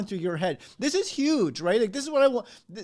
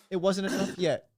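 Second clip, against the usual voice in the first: ordinary talk, nothing marked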